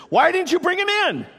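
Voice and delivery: loud, high-pitched outburst; acted shout of a challenge; indignant demand. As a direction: demand an answer from underlings, voice shooting up. Acted demand of religious leaders to guards.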